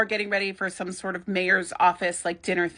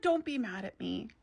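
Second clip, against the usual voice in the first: soft plea; 10 dB quieter than usual. Retold or imitated. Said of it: "And then he's like".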